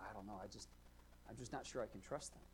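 soft, unsure reply; hesitant admission. Acted. Hesitant member admitting doubt about his church, softly unsure. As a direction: mumble it softly, as if reluctant to commit.

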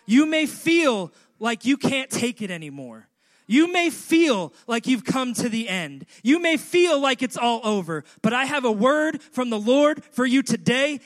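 loud, high climactic call; preaching at full force. Acted. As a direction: shout the promise to the church.

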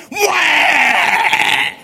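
loud high mock baby wail. Coach mocking the crying kid, loud mock wail. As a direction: bawl it out like a crybaby. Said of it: "And all of a sudden I go".

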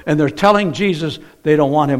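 ordinary talk, nothing marked

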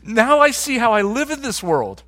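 like finally seeing it clearly; excited realization. Retold or imitated. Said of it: "and you go".

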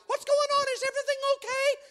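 husband mimicking wife's alarmed question; imitating wife. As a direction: play the concerned wife. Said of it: "she'll be like".

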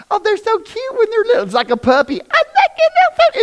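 put-on a parent gushing over a cute baby; mock doting coo. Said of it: "every parent in here is going".

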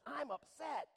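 high, loud mock upset; acting out indignation; indignant. Shown as a demonstration. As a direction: pitch it up like you're offended. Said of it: "Indignant is".

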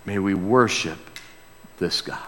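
ordinary talk, nothing marked

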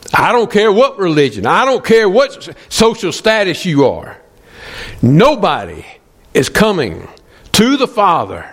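high, insistent pulpit delivery with hard pauses; impassioned preaching. Acted. Preacher warning that nobody comes to the Father otherwise, impassioned.

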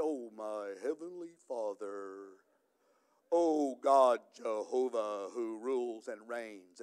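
imitating stiff holy praying; mock pious prayer voice. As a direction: put on a reverent prayer voice. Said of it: "we even change our voice when we talk to God".

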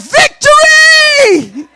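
high, drawn-out victory shout; basketball crowd cheer. Shown as a demonstration. Preacher showing the congregation how fans yell, roaring cheer. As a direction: holler it like a fan at the game. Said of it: "If y'all were at a basketball, y'all be like".

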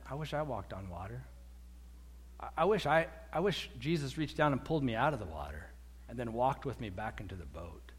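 low, subdued wishing; wistful regret. Acted. Disciples a week later wishing they had walked, quietly wistful. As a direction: trail down softly with longing.

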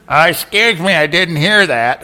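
high, loud mocking voice of a man at the back; mock heckler. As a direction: ham up the clueless man asking again. Replaying the heckler's request.

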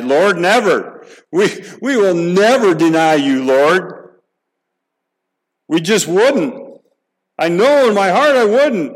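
high, loud and slow, pleading protest; fervent vow. Acted. Preacher voicing Peter's vow to the Lord, fervent and loud. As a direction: swear it with everything you have, voice climbing.

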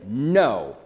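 low, firm falling NO; emphatic no. Shown as a demonstration. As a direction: say "No" as a final answer, no wiggle room. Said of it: "which is an emphatic no".

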